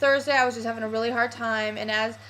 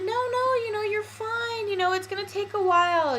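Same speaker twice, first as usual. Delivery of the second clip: much higher, soothing friend voice; imitating comforting friend; 9 semitones higher than usual. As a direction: reassure her like the friend did. Friend consoles her.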